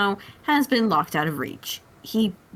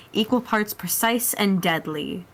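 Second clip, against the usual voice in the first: ordinary delivery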